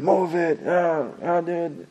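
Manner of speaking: high-pitched imitation of impatient drivers; mock yelling drivers. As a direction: imitate traffic yelling without real volume. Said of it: "everybody's like beeping, yelling".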